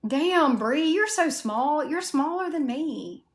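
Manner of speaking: amazed. Real, not acted. Amazed at a small friend.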